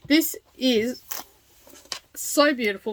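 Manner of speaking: talking through the hiccups; words broken by small hiccups; hiccuping. Real, not acted. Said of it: "I've got the hiccups".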